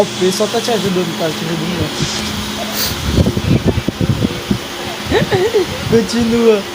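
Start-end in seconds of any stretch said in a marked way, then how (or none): 2.4-5.9 high airy giggle; breathless high giggle; high breathy laugh. Real, not acted. Laughing at vote count. (risada aguda)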